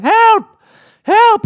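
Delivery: high, loud cries for help; trapped aunt yelling. Acted. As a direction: yell for help from inside the wall. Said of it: "she's like".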